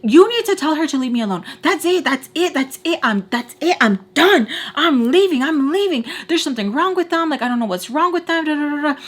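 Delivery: high, worked-up tirade, retold; frantic blurting. Upset, telling him she is leaving, frantic blurting. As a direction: blurt it all out in repeated bursts. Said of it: "I'm, like, blurting so many things out".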